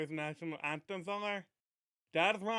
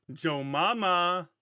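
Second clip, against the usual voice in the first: drawn-out, louder punchline; slow punchline; 6 dB louder, much slower than usual. Acted. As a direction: stretch the punchline out slowly. Punchline of a joke.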